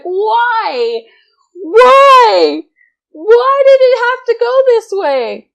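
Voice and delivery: a mock despairing cry to the heavens; anguished laughing wail.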